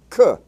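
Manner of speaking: K sounded as a short "kuh". Shown as a demonstration. Teacher showing what C K stands for, K said "kuh". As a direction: say K as its sound, not its letter name. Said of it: "same sound as K".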